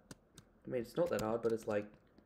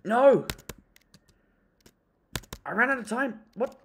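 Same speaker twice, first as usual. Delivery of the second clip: frustrated shout; 9 semitones higher, 7 dB louder than usual. Real, not acted. Loses the parkour round.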